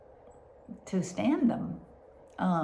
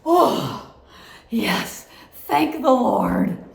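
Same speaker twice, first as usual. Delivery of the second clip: re-enacted loud, high gasp of relief; huge relief; 5 semitones higher, 12 dB louder, much slower than usual. Patient hearing the scan accomplished something, loudly relieved. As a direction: belt out retold thanks, drawing out the last words.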